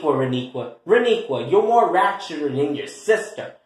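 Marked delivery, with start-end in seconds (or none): none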